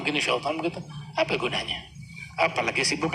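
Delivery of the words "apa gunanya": whispered aside. Real, not acted. Scornful aside.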